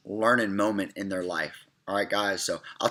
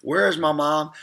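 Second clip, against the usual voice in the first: higher, louder upset child, imitated; mock distressed wail, only lightly acted; retold crying; 5 semitones higher, 4 dB louder than usual. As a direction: retell a child's tearful plea without real sobs. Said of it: "I was just crying and crying".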